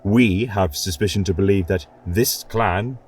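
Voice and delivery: plain reading voice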